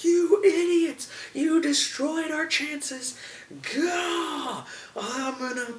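high, slow, raging yell; furious old man shout. Retold or imitated. Satrakian yelling at the others for blowing the plan, high and furious. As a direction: scream at the fools who ruined everything. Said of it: "he just goes off".

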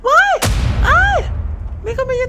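shrieks in real fright, very high and loud; scream, then gasp out what you see; startled scream. Real, not acted. Jump scare at the window. (ตกใจกรี๊ดลั่นเสียงสูงปรี๊ด)

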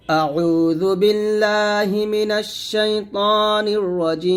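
slow, drawn-out chanted recitation; measured melodic Quranic chant. Shown as a demonstration. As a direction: recite it like tajweed, stretching each word. Teacher recites Quran slowly.

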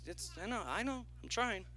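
normal talking voice

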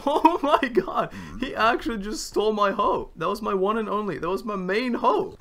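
furious that it was stolen; shouting in disbelief; outraged yell. Real, not acted. Villager steals his hoe.

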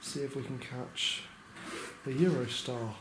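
quiet weary update at night; hushed late-night talk. Real, not acted. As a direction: keep it down, people sleeping.

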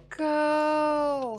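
loud high shout. Real, not acted. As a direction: yell it out. Urging character to run.

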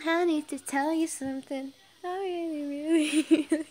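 high, loud, playful overacting; mock-dramatic, cracking up at the end; hammed-up confession. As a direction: overplay the confession until laughter breaks through. Hammed-up reread, breaks into laughing.

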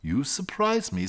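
ordinary delivery

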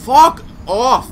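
loud high yell at the game; real rage shouted at the video game; angry outburst. Real, not acted. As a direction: snap furious at the screen. Raging at the game.

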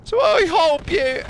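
very high, loud, slow Cockney; ignorant Cockney. Acted. Player resuming demon hunter bit, ignorant Cockney. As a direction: launch into the silly Cockney squawk. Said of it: "like, ignorant Cockney accent".

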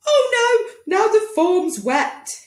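very high, slow dismayed doctor voice; exclaiming doctor. Acted. Doctor to the boy, high dismayed wail. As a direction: wail over the wet form. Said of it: "he exclaimed".

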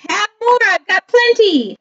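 high, eager offer; train character voice. A narrator's reading. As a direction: pitch it way up as the train.

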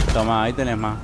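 ordinary delivery

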